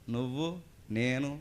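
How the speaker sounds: ordinary delivery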